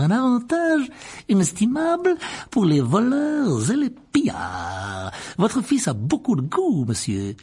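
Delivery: voix aiguë et lente de marchand obséquieux; vieux marchand mielleux. Acted. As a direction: vanter la marchandise avec une onctuosité appuyée. Barjot vante l'objet. (voix de brocanteur doucereux et flatteur)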